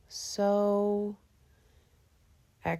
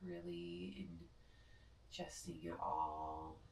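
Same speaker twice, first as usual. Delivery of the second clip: guided meditation; 11 dB quieter than usual. Acted. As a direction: ease them into rest gently. Leads final resting meditation.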